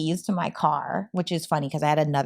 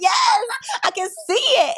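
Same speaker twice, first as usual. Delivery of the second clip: a much higher, louder yes, I can see it; excited shout; 4 dB louder, slower than usual. Real, not acted. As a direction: shout it out, teasing with glee. Teasing friend for getting teary.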